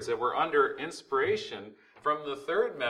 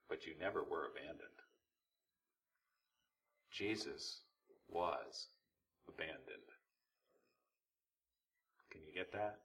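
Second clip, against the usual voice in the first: very soft, low and sober; quiet preacher letting the point sink in; solemn hush; 8 semitones lower, 13 dB quieter than usual. Real, not acted. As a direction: drop right down to a hushed, low voice.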